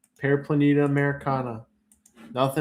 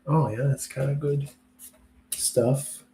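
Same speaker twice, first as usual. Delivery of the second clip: usual speaking voice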